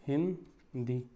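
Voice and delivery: हिंदी said slowly, syllable by syllable; split syllables. Shown as a demonstration. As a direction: stretch हिंदी out into its parts. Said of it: "इसको तोड़ के लिखना हो तो क्या लिखोगे". (हिंदी को धीरे-धीरे तोड़कर बोलें)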